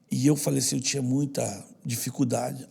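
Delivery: ordinary delivery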